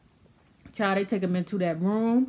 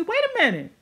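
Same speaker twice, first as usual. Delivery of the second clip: much higher squeaky diva voice, laughing through it; a rich woman fed up, pitched way up; snapping diva; 13 semitones higher, 3 dB louder than usual. Retold or imitated. Coco fed up with vitamin cubes, high squealing diva rant. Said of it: "she snaps and she's like".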